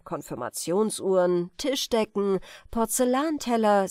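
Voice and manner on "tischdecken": ordinary delivery, nothing marked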